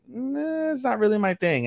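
high, drawn-out "nah"; mock reluctant refusal. Ichikawa turning down Yamada's manga, high reluctant voice. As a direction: decline in a put-on high voice. Retold boy declines a manga offer.

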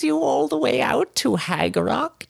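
very high, crooning witch voice; cackling old hag. Acted. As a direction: sweet-talk the cat like a creepy old hag. Hag greets the visitor.

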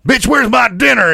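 hammed-up drunk dude demanding dinner; drunk abusive husband. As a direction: bark it like a Lifetime-movie drunk. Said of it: "You come home to a dude that's drunk".

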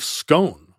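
Scone said with a long O, like stone; alternative pronunciation. Shown as a demonstration. Host offers one way to say the abbey's name, Scone with long O. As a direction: say Scone to rhyme with bone. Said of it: "Any vowel, really".

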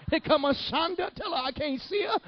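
high, comic tongues gibberish; mock speaking in tongues. Child going through tongues, high comic ecstasy. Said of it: "I was going through tongues".

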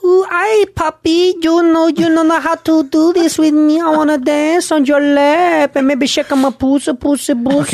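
high, slow, Spanish-sounding fake Japanese; high-pitched Japanese gone Spanish; botched Japanese accent. Shown as a demonstration.

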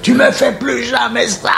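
high, slow furious yell with a laugh; angry shout. Retold or imitated. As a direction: yell at him to never do it again. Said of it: "comment il l'a engueulé".

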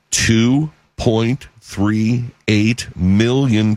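"Two point three eight million" very slow, pausing between words; emphatic figure. Real, not acted. Host stunning listeners with apprehension count, "Two point three eight million" slowly emphasized. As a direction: let each word of "Two point three eight million" land separately.

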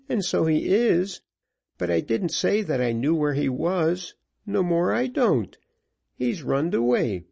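put-on a scared cabin boy begging; pleading boy. A narrator's reading. Frightened boy pleads his case.

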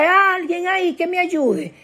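high-pitched cry up out of a pit; calling for help. Acted. Trapped person calls up for anyone to help, high plaintive calling. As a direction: call out high for someone to help, without real volume. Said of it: "Tú empiezas a vocear".

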